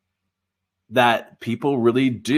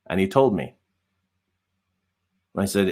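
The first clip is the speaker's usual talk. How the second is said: normal talking voice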